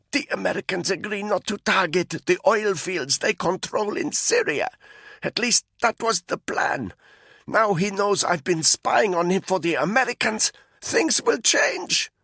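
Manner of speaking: a frightened prisoner talking under a rope; Malinka's voice. A narrator's reading.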